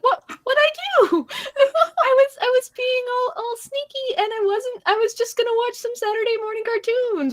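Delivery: put-on very high, whiny child voice; protesting kid. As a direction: imitate a little kid caught sneaking, pleading innocence. Retells protesting as caught child.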